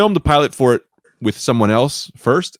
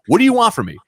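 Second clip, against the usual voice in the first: exasperated; 12 semitones higher, 3 dB louder, faster than usual. Retold or imitated. Retold argument with union collector.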